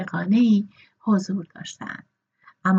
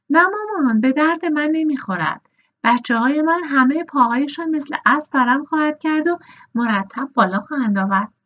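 a defiant, higher-pitched no to her mother; retold refusal; 6 semitones higher, 3 dB louder than usual. Retelling how she refused her mother's marriage plan, mock defiant. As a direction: kick back at the idea like a young girl. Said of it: "گفتم".

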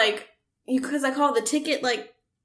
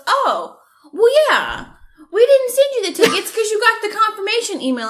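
higher, fast, mock-breezy clerk; 8 semitones higher, 4 dB louder than usual. Mimicking the box office guy explaining tickets, mock breezy. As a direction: rattle it off like an oblivious clerk. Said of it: "he goes".

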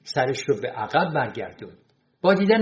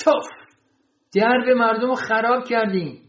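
raised, high-pitched exclamation of disgust; angry scolding; 7 semitones higher than usual. Acted. Janitor inspecting the damaged door, angrily scolding.

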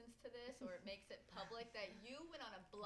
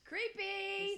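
high, very loud interjection; loud mock outcry; 11 semitones higher, 13 dB louder than usual. Real, not acted. Host breaking into guest's dance story, loud high outcry. Host heckles the retold pick-up line.

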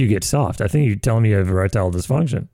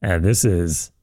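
depressed; 5 semitones lower, much slower than usual. Real, not acted. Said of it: "Can you do it less depressed?".

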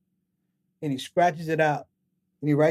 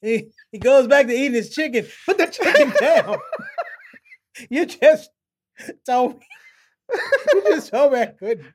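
high, louder words broken by laughter; laughing while talking; 10 semitones higher, 6 dB louder than usual. Real, not acted. Guest recalling mentor's put-down, laughing through the words. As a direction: talk through real laughs.

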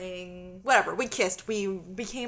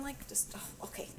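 whispered; 3 semitones higher, 14 dB quieter than usual. Real, not acted. Itching fit, voice drops away.

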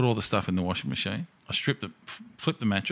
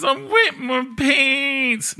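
put-on much higher, slow little baby voice; babyish whine; 5 dB louder, much slower than usual. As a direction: whimper it like a toddler. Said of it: "waving her arms like a baby".

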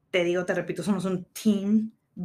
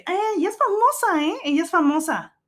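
put-on high, loud bragging girl voice; excited announcing; 10 semitones higher, 4 dB louder than usual. Fan telling her friends the chef is famous, high excited voice. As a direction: show her off to the group, gushing. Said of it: "Y luego ella les dice".

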